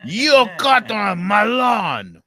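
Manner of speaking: put-on high, loud Italian accent with dragged-out vowels; hammed-up Italian neighbor hollering slowly. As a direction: repeat the accusation in a booming mock Italian accent. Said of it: "I could do the accent".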